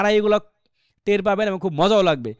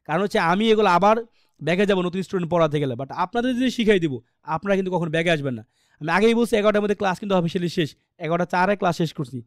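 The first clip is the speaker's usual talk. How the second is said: ordinary talk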